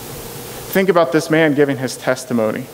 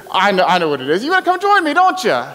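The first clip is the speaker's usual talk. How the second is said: mocking, much higher sing-song; the healed man mocking the Pharisees, sarcastic; sarcastic taunt; 5 semitones higher, faster than usual. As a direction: tease them like a knowing smart aleck. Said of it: "being so sarcastic".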